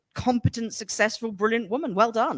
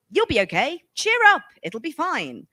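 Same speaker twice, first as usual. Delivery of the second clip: mock dismissive cheer; 8 semitones higher than usual. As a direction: brush it off with a bright chirp, imitating. Retold mother brushing off daughter.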